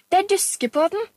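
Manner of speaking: put-on high, loud little-girl call; child's boastful shout. A narrator's reading. Pia-Pia calls back into the room, boastful child's shout. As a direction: call out one last defiant boast. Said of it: "ropte hun".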